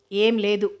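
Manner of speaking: ordinary delivery, nothing marked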